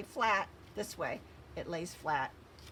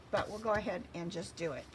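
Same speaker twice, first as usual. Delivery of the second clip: normal talking voice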